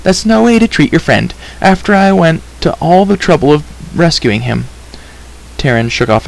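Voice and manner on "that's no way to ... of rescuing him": put-on high-pitched scolding girl; high, indignant girl's voice; crying out in protest. A narrator's reading. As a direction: scold a friend, pitched well up. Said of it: "she cried".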